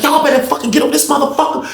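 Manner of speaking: high-pitched imitation of an urgent call; frantic angry demand. Officer on the phone for backup, high and angry.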